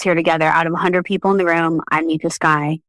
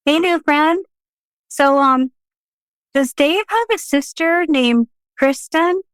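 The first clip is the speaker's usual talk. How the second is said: higher, slow, sing-song probing; awkward cheerful ask; 10 semitones higher than usual. Retold or imitated. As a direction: tiptoe into the odd question. Awkwardly asking a new friend.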